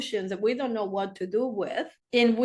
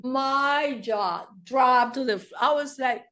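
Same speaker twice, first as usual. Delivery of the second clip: normal talking voice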